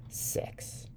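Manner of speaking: very soft whisper; hushed answer. A narrator's reading. Sybil telling the young man her count, hushed. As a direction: whisper it like a child's secret.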